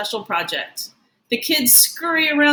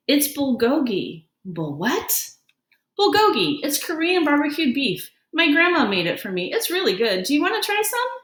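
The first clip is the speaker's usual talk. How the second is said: put-on bright, higher kid voice offering food; eager new kid sharing lunch; lightly voiced cheerful boy; friendly boy character; 5 semitones higher than usual. Justin explains his lunch.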